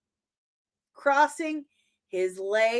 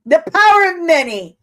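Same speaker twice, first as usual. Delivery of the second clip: loud, fast booming mock slogan; mock announcement; 5 semitones higher, 11 dB louder, faster than usual. Viewer quoting the trailer, loud mock-dramatic boom. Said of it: "I'm kidding".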